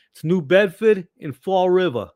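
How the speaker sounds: Boston accent with "New Bedford" said "New Beddfid" and "Fall River" as "Fall Rivah". Shown as a demonstration. Host shows locals how to say the town names, in a Boston accent. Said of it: "I'm getting my Boston accent back here".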